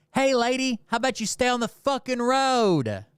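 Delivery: high-pitched holler echoing the cop; retold sarcastic call-out; mocking call. Angry bystander to lady driver, mockingly calling out.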